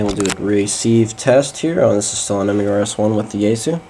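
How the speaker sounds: ordinary delivery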